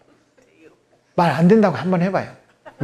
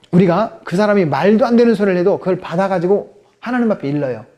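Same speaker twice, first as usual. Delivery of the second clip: ordinary delivery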